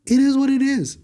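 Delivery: high sarcastic shrug, it is what it is; mocking resignation. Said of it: "And I gotta say".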